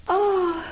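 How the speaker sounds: high, drawn-out "och"; lone sigh. A narrator's reading. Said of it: "ozvalo se jen".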